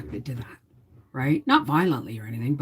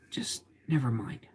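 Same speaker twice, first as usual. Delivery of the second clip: whispered brush-off; 3 semitones lower, 8 dB quieter than usual. Retold or imitated. Wife repeating her reply to her husband, in a whisper. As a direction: trail off into a whisper.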